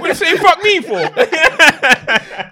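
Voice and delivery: high, loud, laughing mock indignation; laughing outrage. Real, not acted. Confronting his friend over the insult, laughing outrage. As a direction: demand an answer while cracking up.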